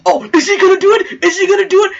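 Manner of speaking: breathless hope, fans on the edge of their seats; excited fans. Retold or imitated. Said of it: "they're like".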